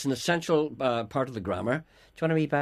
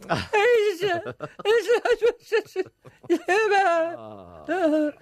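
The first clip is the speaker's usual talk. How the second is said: loud helpless laugh; real loud laughter; burst of laughter; 6 dB louder than usual. Real, not acted. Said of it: "I nearly laughed there".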